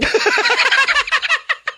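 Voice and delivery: high laugh. Real, not acted. Loud burst of laughter.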